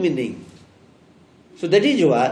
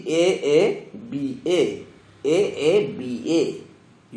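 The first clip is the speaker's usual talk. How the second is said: slow dictation; 4 semitones lower, slower than usual. Shown as a demonstration. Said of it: "you mark".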